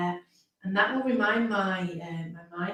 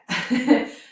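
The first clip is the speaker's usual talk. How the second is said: brief, loud, higher chuckle; short laugh; 3 semitones higher, 10 dB louder than usual. Real, not acted. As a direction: laugh it off at your own forgetfulness. Chuckles at forgetting her own age.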